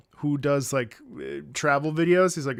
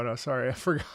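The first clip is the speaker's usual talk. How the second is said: ordinary talk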